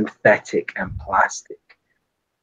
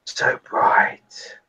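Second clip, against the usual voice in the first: whisper. Acted. Reflection recalling blinding light.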